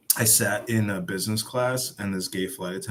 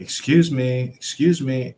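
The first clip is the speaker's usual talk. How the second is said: raised voice calling after her; 6 semitones higher, 7 dB louder, slower than usual. Retold or imitated. To the airline employee who walks away, calling out loudly. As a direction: call out to get her attention.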